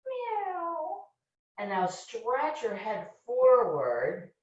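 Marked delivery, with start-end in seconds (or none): 0.1-1.0 high, soft cat meow rising at the end; a playful cat sound for the kids. Shown as a demonstration. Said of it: "breathe out with a meow".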